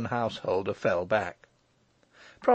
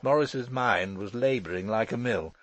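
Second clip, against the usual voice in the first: ordinary delivery, nothing marked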